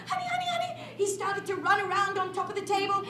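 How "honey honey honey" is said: very high, quick honey-honey cries; very high scared cub voice; panicky little bear. Acted. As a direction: squeak it out like a stuck cub. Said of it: "he was like".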